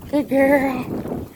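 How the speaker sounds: lilting dog-praise voice; puppy praise. Real, not acted. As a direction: encourage the pup with happy praise.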